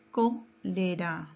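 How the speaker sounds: slow "Cólera", held on "Có"; antepenultimate stress. Shown as a demonstration. Teacher confirming the antepenultimate syllable, drawn-out stress. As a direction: stretch the first syllable of "Cólera", then "lera". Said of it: "antepenúltima sílaba".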